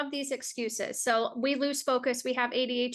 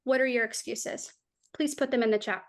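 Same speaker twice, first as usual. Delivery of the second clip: ordinary delivery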